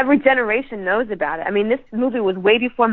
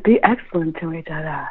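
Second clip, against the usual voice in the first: low-voiced, badly faked movie dude; a lightly put-on slacker movie voice, pitched down; bad Bill and Ted; 4 semitones lower than usual. Co-host trying a really bad movie impression, badly faked dude voice. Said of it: "really bad Bill and Ted impression".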